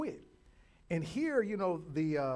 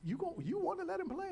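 worried mom; 11 semitones higher than usual. Retold or imitated. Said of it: "his mom was like".

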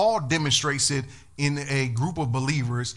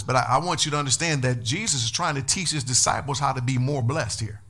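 ordinary talk, nothing marked

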